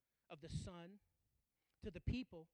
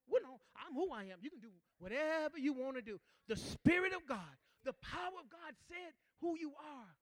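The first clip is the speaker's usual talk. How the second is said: louder, higher shout of conviction; preaching shout; 6 semitones higher, 11 dB louder than usual. Acted. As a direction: lift it to a shouted climax. Preaching climax.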